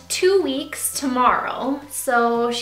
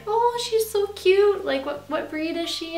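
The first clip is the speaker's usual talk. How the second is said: admiring strangers; 6 semitones higher than usual. Retold or imitated. People who meet the puppy out somewhere, gushing. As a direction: imitate people gushing over a cute puppy. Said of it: "they're like".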